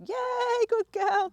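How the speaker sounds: high, bright praise for the dog; excited dog praise. Real, not acted.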